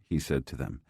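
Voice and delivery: usual speaking voice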